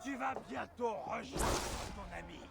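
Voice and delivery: high, shouted battlefield jeer; mock the foe at full voice mid-fight; shouted taunt. Enemy taunting in combat. (raillerie criée en plein combat)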